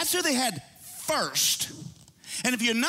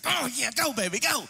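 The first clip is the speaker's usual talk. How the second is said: excited cheer; 10 semitones higher than usual. Retold or imitated. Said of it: "it's like".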